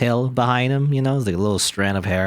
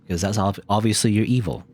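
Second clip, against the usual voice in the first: ordinary talk, nothing marked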